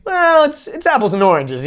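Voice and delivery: high-pitched waffling; mock hedging. Podcaster trying out slang for yes and no, mock hedging. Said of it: "Be like".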